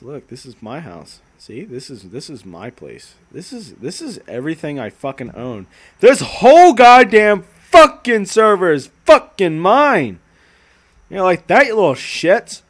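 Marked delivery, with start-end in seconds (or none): 0.0-3.1 much softer, hushed; quiet possessive. Pretend. Soft possessive. 6.0-12.6 loud gloating boast; boastful. Pretend. Streamer taunting viewers that the server is his, loudly gloating.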